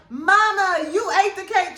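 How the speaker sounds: high, loud accusing cry; high-pitched mock accusation; accusing Flo. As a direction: catch mama in the act, shocked. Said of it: "Flo like".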